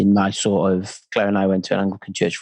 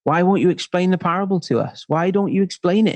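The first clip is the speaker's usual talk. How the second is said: higher-pitched mock exasperation; plead, as if at your wits' end; exasperated; 7 semitones higher than usual. Crowd demanding Jesus explain the parable, acted exasperation. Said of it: "People were doing their nut".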